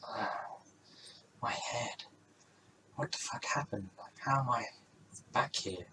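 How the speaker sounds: soft, breathless dazed mutter; like coming round with a sore head; groggy whisper. Acted. Rosie waking up in the lanes, groggy whisper.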